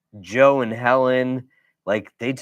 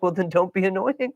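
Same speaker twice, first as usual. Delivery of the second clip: blunt retort; 9 semitones higher than usual. Retold or imitated. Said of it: "And I'm like".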